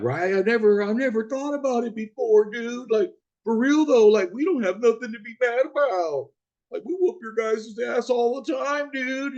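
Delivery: high, loud voicing of a laughing passenger in the back; passenger's voice. Chiefs fan passenger admits the driver has a point, high and loud. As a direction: play the Chiefs fan in the back seat, suddenly agreeing.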